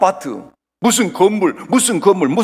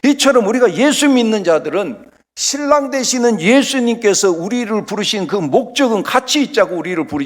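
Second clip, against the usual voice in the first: ordinary talk, nothing marked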